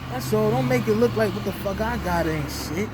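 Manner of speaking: indignant loud declaration; loud rant. Real, not acted. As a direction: slam the point home. Heated rant.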